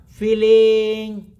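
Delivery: FEELING held long and loud, level in pitch; drawn-out. Shown as a demonstration. Teacher urging students to stop thinking, drawn-out.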